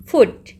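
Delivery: फुट short and loud. Shown as a demonstration. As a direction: punch फुट with a brief oo. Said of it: "ये छोटा सा है".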